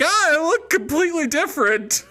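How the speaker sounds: high, loud mock gasp of surprise; mock amazement. Player mocking her character seeing herself in sunlight. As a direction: squeal it in exaggerated shock.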